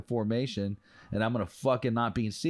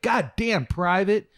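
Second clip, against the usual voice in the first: high, loud surprised exclaim; impressed drill sergeant; 10 semitones higher, 6 dB louder, slower than usual. Retold or imitated. Drill sergeant amazed by a private's boots, loud and high. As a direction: let it burst out, amazed.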